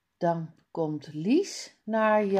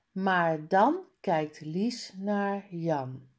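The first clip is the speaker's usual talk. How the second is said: plain reading voice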